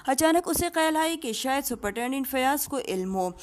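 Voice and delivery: ordinary delivery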